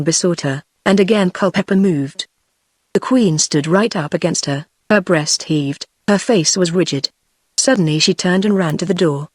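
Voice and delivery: usual speaking voice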